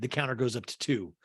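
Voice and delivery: ordinary delivery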